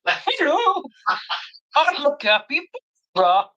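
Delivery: lightly voiced cute capybara, slow. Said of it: "different bears with different voices".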